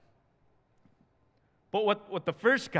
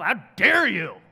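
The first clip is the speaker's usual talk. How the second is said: mock outrage; 3 semitones higher, 9 dB louder than usual. Mock outrage at small difference.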